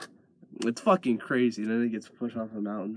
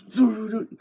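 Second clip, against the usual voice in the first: hummed gun tune; 11 semitones higher, 8 dB louder than usual. Retold or imitated. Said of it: "he pulls out his gun and he goes".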